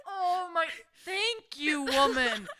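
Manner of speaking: a hammed-up crying shout of relief, pitched high; sobbing mock gratitude, loud and high; mock tearful thanks. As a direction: wail your thanks like she saved your life. Retold rescue by old lady.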